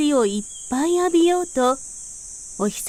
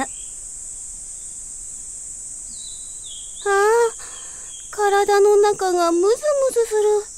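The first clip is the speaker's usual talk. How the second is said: higher little voice stretching awake; a flower root yawning itself awake, voiced higher; sleepy root waking; 6 semitones higher than usual. A narrator's reading. Flower root waking in spring, higher stretching voice. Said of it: "そんなことを言いました".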